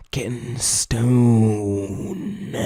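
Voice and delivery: drawn-out, very slow mock commentary; slow-motion mocking delivery; mock dull commentary. Shown as a demonstration. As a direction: stretch the words right out. Mock example of bad commentary.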